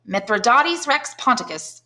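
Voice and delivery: put-on a clever boy showing off, slowly; a schoolboy reciting proudly; child voice. A narrator's reading.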